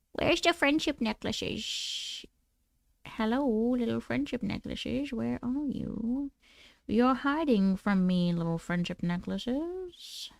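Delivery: slow, hushed creepy scientist coaxing; soft, sinister coaxing voice; mad scientist. Pretend. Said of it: "You love my mad scientist voice?".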